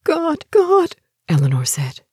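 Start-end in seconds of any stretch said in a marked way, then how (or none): 0.0-1.0 loud, high-pitched cry of horror; horrified cry. A narrator's reading. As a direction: cry out to God in terror. Horrified, shuddering in a corner.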